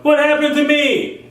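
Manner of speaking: high, lost voice; high dismayed cry; mock bewildered victim. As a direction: pitch it way up in dismay. Voicing a victim.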